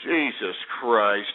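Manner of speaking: low, slow, drawn-out Jesus Christ; exasperated groan. Real, not acted. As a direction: sigh it out in disgust. Host exasperated after rant.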